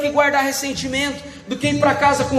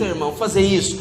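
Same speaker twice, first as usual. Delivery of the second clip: ordinary talk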